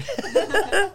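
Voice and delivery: bright laugh. Real, not acted. Laughs after compliment.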